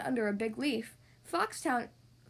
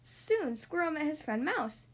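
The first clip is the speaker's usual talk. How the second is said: usual speaking voice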